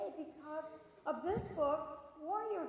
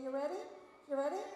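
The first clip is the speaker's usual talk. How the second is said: ordinary talk, nothing marked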